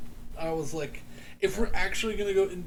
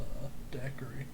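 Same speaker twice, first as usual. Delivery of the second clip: whispering; 5 semitones lower, 8 dB quieter than usual. Real, not acted. Whispered drink order.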